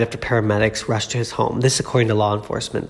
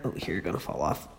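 whispering; 6 semitones lower, 14 dB quieter than usual. Real, not acted. Quietly settling the dog.

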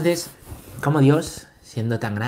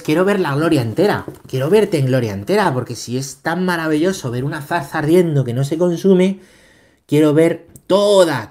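loud, raised, insistent acted request; eager plea; 6 semitones higher, 9 dB louder than usual. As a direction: beg to see it all, projecting with fervor. Acts out Moses' eager request to God. (súplica entusiasta en voz alta)

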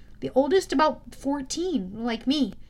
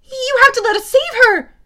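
high, loud pleading yell; Percy yelling at the hunters; 11 semitones higher, 13 dB louder than usual. A narrator's reading. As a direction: beg them loudly to save her. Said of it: "I yelled".

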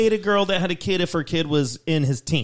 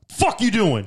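abrupt, slightly raised dad voice; 3 semitones higher, slower than usual. Shown as a demonstration. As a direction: demonstrate a stern dad calling out a kid. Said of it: "I'll give you my dad voice".

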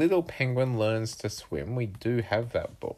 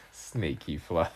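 ordinary delivery, nothing marked

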